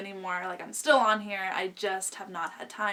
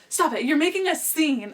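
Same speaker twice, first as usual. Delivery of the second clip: raised, louder mock telling-off; imitated scolding boyfriend; 6 semitones higher, 5 dB louder than usual. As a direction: mimic his indignant complaint from the car. Boyfriend on car tells her off.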